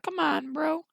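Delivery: high, pleading donkey voice. Acted. Donkey begs Shrek for help, high and pleading. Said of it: "Donkey's like".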